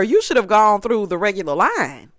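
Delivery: ordinary delivery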